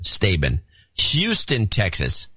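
ordinary talk

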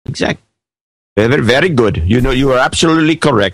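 put-on understated Indian accent; faint Indian accent; mock Indian accent. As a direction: speak in a light Indian accent. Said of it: "that accent".

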